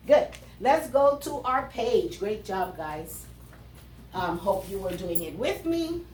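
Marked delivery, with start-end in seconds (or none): none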